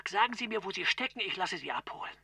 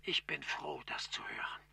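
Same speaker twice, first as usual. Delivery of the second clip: stimmlos und leise; leises Flüstern; 5 semitones lower, 9 dB quieter than usual. Acted. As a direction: erleichtert hingehaucht. Chief relieved on the phone. (der Chef erleichtert am Telefon, geflüstert)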